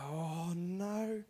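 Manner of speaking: soft, drawn-out sinking realisation; quiet dread. Acted. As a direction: let it sink out under your breath. Acted dread at being summoned.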